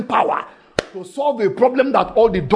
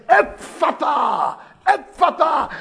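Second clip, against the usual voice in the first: Jesus commanding the deaf man to open; commanding cry; 7 semitones higher, slower than usual. Acted. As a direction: call it out with authority. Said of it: "and he said".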